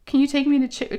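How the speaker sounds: put-on high, pleading child's request, mildly upset; imitating upset kid. Daughter bargaining before the doctor's visit, mock upset. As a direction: mimic an upset kid asking for a treat. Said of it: "upset, almost crying".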